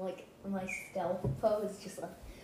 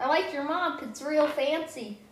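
mock compliment; 7 semitones higher, 7 dB louder than usual. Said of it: "I'm like".

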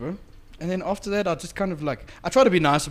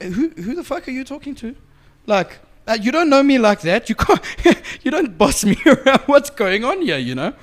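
louder, higher, mock outraged rant; indignant retort; 8 semitones higher, 8 dB louder than usual. Snapping at the girlfriend for bossing him, loud half-laughing outrage. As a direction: tell her off with a laugh breaking in.

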